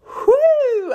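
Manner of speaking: high loud nervous whoo. Real, not acted. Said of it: "am I nervous".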